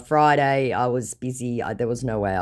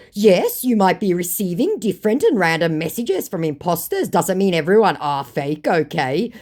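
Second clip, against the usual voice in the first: scammer voice; 6 semitones higher, 5 dB louder than usual. Retold or imitated. Voicing scammer's messages.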